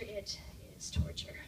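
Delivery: slow, voiceless whisper; drawn-out dying whisper; fading to whisper. Acted. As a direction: whisper it slowly, as if the last strength is gone. Poem repeats line, fading away.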